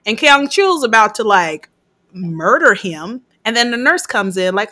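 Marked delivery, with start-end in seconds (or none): none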